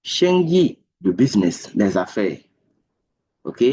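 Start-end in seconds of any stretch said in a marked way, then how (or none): 0.0-0.8 "Shengyi" said clearly and set apart, higher pitch; teacher sounds out "Shengyi" for the learners; model Mandarin word. Shown as a demonstration. Teacher modeling a Chinese word for French students. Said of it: "Quand on dit".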